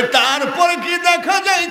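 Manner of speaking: hammed-up wail in waz melody; acted crying shout. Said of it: "চিৎকার করে কান্দে". (উঁচু সুরে কান্নার অভিনয়)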